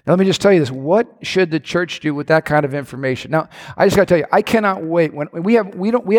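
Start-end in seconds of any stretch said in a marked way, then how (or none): none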